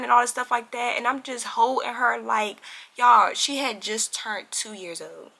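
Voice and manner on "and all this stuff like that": ordinary talk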